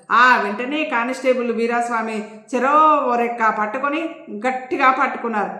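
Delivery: usual speaking voice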